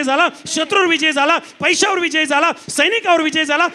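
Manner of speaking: fiery pulpit delivery; preaching at full force. Acted. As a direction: hammer each line home to the crowd. Preacher building to a climax.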